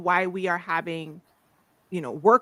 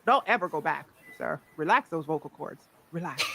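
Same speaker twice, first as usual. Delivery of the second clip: lower, softer, playful coaxing; dropped, relaxed voice; 5 semitones lower, 6 dB quieter than usual. Retold or imitated. Telling the man never to go back, low and coaxing. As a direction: drop low to coax him to relax.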